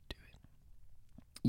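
breathy whispered command; whispered urge. Pretend. Urging listeners to watch the film, whispered. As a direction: whisper it like a secret nudge.

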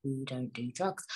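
hushed whisper aside; mock secretive whisper. Real, not acted. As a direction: whisper it conspiratorially. Joking retraction.

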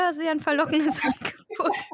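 laughing through a teasing remark; amused irony. Real, not acted. Host teases guest about the exorcism offer, laughing irony.

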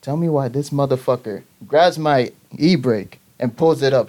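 normal talking voice

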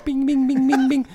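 high, ringing "bing" repeats; imitated finger cymbals. Storyteller mocking friend in lotus position, high chiming bings. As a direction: chime like tiny cymbals. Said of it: "med små tumsymboler".

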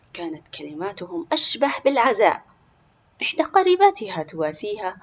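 ordinary delivery, nothing marked

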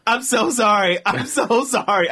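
high, pleading apology, half laughing; stretched, imploring sorry; retold desperate apology. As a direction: plead forgiveness in mock distress. Tearful apology to cleaner, retold.

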